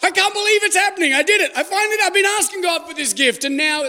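high, fast and thrilled; overjoyed new believer; excited. Retold or imitated. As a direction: gush like it's brand new. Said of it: "you're like".

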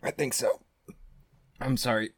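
choking. Real, not acted. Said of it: "Did you swallow a bug?".

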